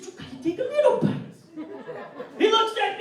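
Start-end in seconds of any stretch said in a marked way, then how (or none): 0.0-1.2 fast, high fatherly jab; dad's catchphrase, high and fast; quick high dad voice. Retold or imitated. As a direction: rattle it off like the know-it-all dad. Father's punchline repeated.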